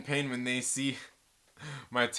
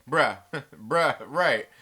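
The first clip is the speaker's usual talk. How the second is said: self-impression; 8 dB louder, much slower than usual. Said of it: "first rule to impersonating me".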